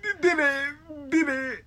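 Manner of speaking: high-pitched mimicking of a denial; mocking echo. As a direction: parrot the friend in a high voice. Mockingly echoes friend's denial.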